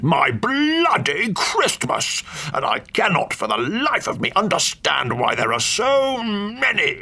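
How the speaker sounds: slow, rising cranky tirade pitched high; an indignant old lord building to fury; ranting old duke. Acted. Duke rants at the uninvited guest about his ruined Christmas.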